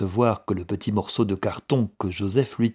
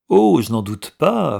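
higher, louder sarcastic agreement; mocking; 6 semitones higher, 8 dB louder than usual. A narrator's reading. Brother doubting the promise to write, mocking. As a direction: jeer with exaggerated assent. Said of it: "répondit son frère d'un ton narquois".

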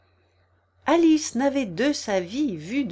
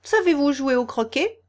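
loud, raised-pitch question; shouted; 5 semitones higher, 5 dB louder than usual. A narrator's reading. Queen asking Alice about croquet, calling out. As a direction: call out the question loudly. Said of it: "cria la reine".

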